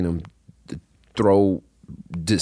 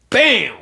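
sudden exclamation; 6 dB louder than usual. Retold or imitated. Snake strike retold.

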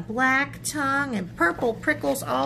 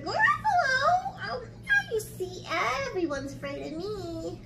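smug mouse; 12 semitones higher than usual. Acted. Mouse proving everyone fears it, high and smug. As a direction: gloat in a high little voice.

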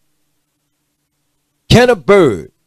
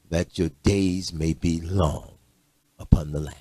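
solemn soft scripture; 9 semitones lower, 11 dB quieter than usual. Acted. Slow solemn scripture quote.

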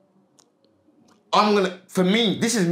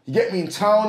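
ordinary talk

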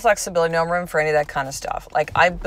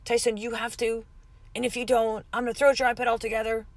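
disciplinarian parent; 5 semitones higher than usual. Pretend. Mom imagining threatening Tyson over the iPad, mock stern. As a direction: pretend strict mom, higher, rushing into a threat. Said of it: "I could be the disciplinarian again".

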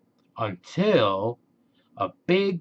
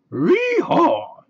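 high, loud cartoon dog yee-haw; Scooby ropes the thief and cheers; 12 semitones higher, 7 dB louder than usual. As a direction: whoop it like a happy dog cowboy. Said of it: "Scooby cheered".

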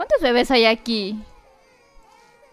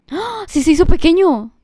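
high gasp, then loud astonishment; astonished, pitched well up; amazed gasp and exclamation; 6 semitones higher, 9 dB louder than usual. Real, not acted. Startled that Sonic shrank.

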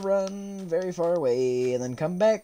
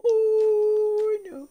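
very high scared shriek. Real, not acted. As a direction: shriek in sudden fright. Sudden scare.

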